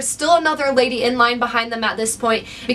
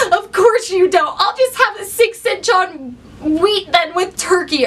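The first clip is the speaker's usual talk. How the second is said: tearful, slowed half-laugh half-sob, a little higher; mock crying; 4 semitones higher than usual. Crying customer told there are no meatballs, mock sob. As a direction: imitate a weepy customer giving up on meatballs. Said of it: "the lady that comes in crying".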